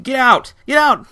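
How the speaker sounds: high, loud yelling get out; furious shout. Retold or imitated. Woman chasing the narrator out, furious shout. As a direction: drive him out, screaming. She throws things at him.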